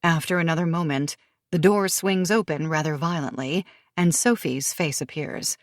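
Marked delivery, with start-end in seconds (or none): none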